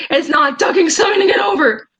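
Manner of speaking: a touch of an angry outburst; mild shout. Retold or imitated. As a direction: push it out like a heated reply. Reading out an angry chat reply.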